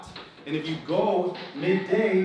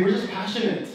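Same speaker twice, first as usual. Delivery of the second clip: raised, higher, emphatic self-defence; punchy, mock-defensive outburst; loud and passionate; 4 semitones higher, 5 dB louder than usual. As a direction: protest it loudly, with passion. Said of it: "we're very loud".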